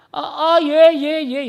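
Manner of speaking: very high, loud, repeated assent; acted startled neighbour suddenly remembering; flustered acknowledgement.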